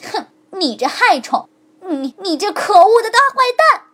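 rising angry scolding; furious insult. A narrator's reading. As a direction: spit out the insults. Bone insults the fox. (骨头越骂越响)

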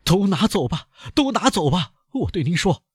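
high, despairing banker crying take it all; high loud banker giving up everything; loud outburst. Acted. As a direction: throw it all at him in despair. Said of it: "唐格拉尔大声地说".

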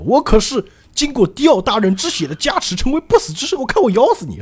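high-pitched, worked-up villain boast; blustering threat. Acted. Undying villain lunges to bite, blustering threat. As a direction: rant excitedly, building to an attack.

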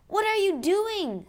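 high, loud startled yell; shouting at a friend with a rifle; alarmed shout. A narrator's reading. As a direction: cry out in alarm. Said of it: "Scott shouted".

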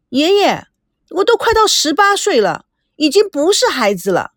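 put-on high-pitched girlish protest. A narrator's reading. Teenager insisting to grandfather she is grown up, protesting. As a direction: object indignantly, voice pitched well up. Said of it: "赵美娇抗议".

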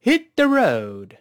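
放慢领读hit the road，调子起得高; 带学生读一遍，road拖长下降. Shown as a demonstration. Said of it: "再来一遍". (慢速带读，高起后落)

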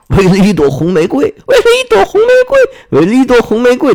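high, loud chorus of disbelief; incredulous outcry. A narrator's reading. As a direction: cry it out in rising, squeaky astonishment. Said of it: "他们都叫了起来".

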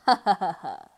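loud, low villain's cackle; evil gloating laugh. Acted. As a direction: laugh in smug triumph. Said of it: "得意地大笑".

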